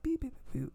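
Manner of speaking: high little beeps imitating a computer; playful imitation of computer beeps; mock beeping. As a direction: beep it out like a machine.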